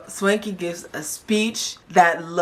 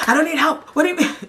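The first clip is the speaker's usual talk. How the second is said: high, protesting imitation of his agitation; retold outburst; 10 semitones higher, faster than usual. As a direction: mimic him getting worked up, voice jumping high. Acting out Swanky getting worked up.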